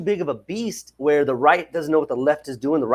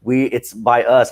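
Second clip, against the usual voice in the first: ordinary talk, nothing marked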